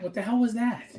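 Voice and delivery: normal talking voice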